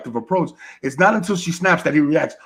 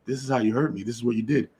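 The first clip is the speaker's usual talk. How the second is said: quiet hurt words; 2 semitones lower, 7 dB quieter, faster than usual. Retold or imitated. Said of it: "looks at him and says".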